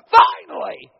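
loud shouted finally; exclaiming. Real, not acted. Preacher exclaiming to the congregation, loud. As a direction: burst out with it.

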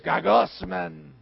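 slow, groaning gagusman; grumbling gagusman. Pretend. Complaining about the kids, slow groaning grumble. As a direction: drag out gagusman in a sulk.

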